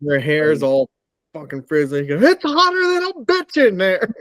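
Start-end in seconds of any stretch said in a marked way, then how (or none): 2.2-4.1 high, mock whiny old lady; high-pitched imitation of her complaining about the heat; mock mom complaint. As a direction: squeal it like a fed-up mom. Mom voiced in cabin story.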